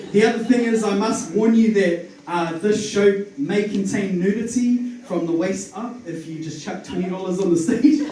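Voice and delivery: ordinary talk, nothing marked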